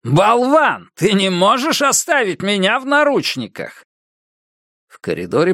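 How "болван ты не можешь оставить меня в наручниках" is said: furious yell. A narrator's reading. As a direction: yell it at the guards. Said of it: "разъярённого Сэма".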